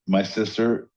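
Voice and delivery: usual speaking voice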